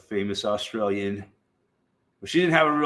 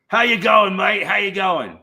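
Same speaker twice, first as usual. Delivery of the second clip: higher, louder hammed-up Australian accent greeting; mock Australian accent; 8 semitones higher, 5 dB louder than usual. Streamer greeting a viewer in a mock Australian accent. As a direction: greet the chat like a cheery Aussie in a put-on Australian accent. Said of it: "I'm learning to speak Australian".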